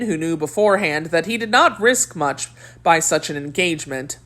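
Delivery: usual speaking voice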